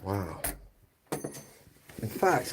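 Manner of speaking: ordinary talk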